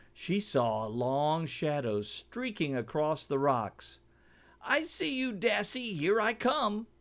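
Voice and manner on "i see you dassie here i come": high-pitched gleeful bird call; screeching eagle. A narrator's reading. Eagle gloating as he swoops at Timby. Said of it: "eagle swooping on Timby".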